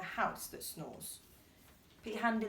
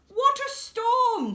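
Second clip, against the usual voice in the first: high, louder, slow bird chirp; twittering wren; 12 semitones higher, 7 dB louder, slower than usual. Acted. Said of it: "twitters wren".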